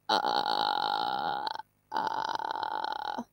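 low creaky vocal fry. Shown as a demonstration. Teacher demonstrates vocal fry to students. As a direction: crackle it out low in the throat. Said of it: "porque suena como".

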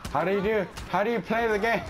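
high, flustered question; panicked high voice. Real, not acted. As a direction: panic as nothing works. Flustered at losing in the game.

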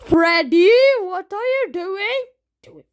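ordinary talk, nothing marked

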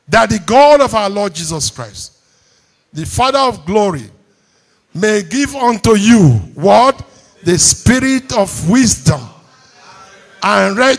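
loud, high declaimed prayer; praying scripture aloud. Real, not acted. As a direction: pray the verse out forcefully as you read. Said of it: "I want to pray this prayer as I read it".

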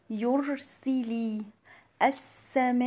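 "يرسل" chanted very slowly, full i on س and ل; clear kasra. Shown as a demonstration. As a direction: recite "يرسل السماء" drawing out each kasra. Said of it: "والسين واللام مكسورين أكثرهم كويس". ("يرسل" بكسر السين واللام كسرًا واضحًا)

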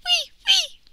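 very high, loud insect whine; piercing little "wee" cries; weeping mosquito. A narrator's reading. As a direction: squeal like a tiny mosquito. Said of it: "Who's that weeping in the wind?".

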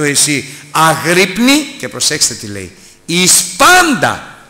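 preacher's loud, drawn-out exhortation; fiery preaching. Acted. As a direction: exhort the flock with full force. Preaching at full force.

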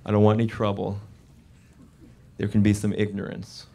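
low, wary talk to oneself; nervous self-caution. Retold or imitated. Comic in the South telling himself to behave, mildly nervous. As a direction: keep your head down and warn yourself. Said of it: "I just realized I was nervous".

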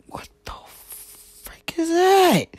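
slow high startled whisper; surprised whisper. Real, not acted. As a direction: breathe it out in shock. Startled mid-makeup, whispering.

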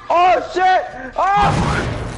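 high, loud yelp of alarm; real panicked shout; startled shout. Real, not acted. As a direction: cry out as the killer appears. Killer appears in horror game.

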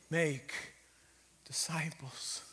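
soft, unvoiced "Make disciples"; whispered command. Real, not acted. Preacher whispers Jesus' last command to the congregation. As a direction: breathe it out reverently. Solemn scripture quote.

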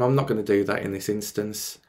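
ordinary talk

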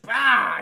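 loud burst of mock spitting; imitated spitting noise. Acting out Dick Miller spitting in his face, loud mock spit. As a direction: blurt out an exaggerated spit sound.

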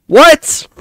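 high, loud startled what; disbelieving yelp; shocked. Real, not acted. Shocked reaction to gameplay.

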